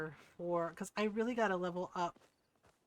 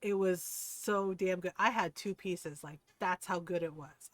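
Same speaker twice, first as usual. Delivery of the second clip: normal talking voice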